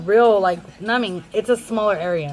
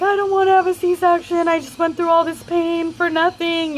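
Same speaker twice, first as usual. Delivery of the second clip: high, tearful, pleading voice, lightly imitated; retold crying plea; 8 semitones higher than usual. Mother in labour begging the doctor, in a mock tearful cry. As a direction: replay the sobbing protest against surgery. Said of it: "bawling my eyes out".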